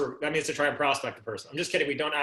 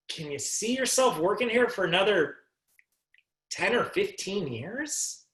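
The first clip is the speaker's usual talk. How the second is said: raised pitch, with "ten or fifteen years" drawn out and rising; incredulous question for effect; 5 semitones higher, slower than usual. Shown as a demonstration. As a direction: scrunch the shoulders and stretch "fifteen years" up into a question. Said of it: "Now watch my face and watch my shoulders".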